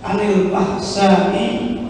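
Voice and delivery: ordinary talk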